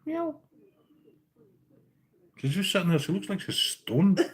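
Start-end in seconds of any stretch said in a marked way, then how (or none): 0.0-0.4 very high, soft meow for the cat; quiet high-pitched kitty meow; mock cat meow. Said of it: "squawk then, say something".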